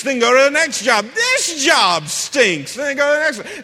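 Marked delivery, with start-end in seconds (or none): 1.1-2.7 slow, loud, high mock complaint; griping worker. Preacher mocking people who quit jobs, loud slow grumble. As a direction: drag out the gripe like a sulky worker.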